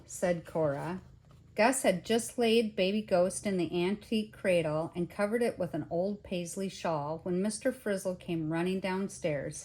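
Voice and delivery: plain reading voice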